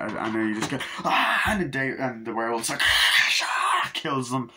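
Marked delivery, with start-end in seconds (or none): 1.0-1.4 high, loud mock scream; sudden yell of fright; imitated victim scream. Reviewer acting out the victim attacked, scream. 2.9-3.9 loud voiceless mock roar; rasping breathy attack noise; imitated werewolf snarl. Reviewer acting out the werewolf killing, snarl.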